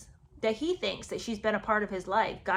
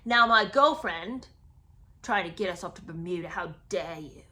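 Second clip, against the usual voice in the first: louder, sarcastic, then hissed at the end; mock outraged Harry; 6 dB louder than usual. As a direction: ham up a wounded prince accusing Camilla. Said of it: "And Harry's like".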